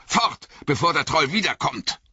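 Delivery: the wizard urges them to leave; Gandalf calling. A narrator's reading. Gandalf ruft zum Aufbruch, drängend. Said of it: "rief Gandalf".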